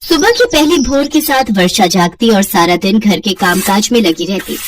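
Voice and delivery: ordinary delivery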